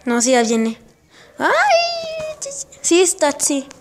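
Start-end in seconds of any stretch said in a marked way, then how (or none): 1.4-2.4 long, very high squealed "ay". Real, not acted. Player sees the game monster coming, high squeal. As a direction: squeal in alarm as the threat arrives.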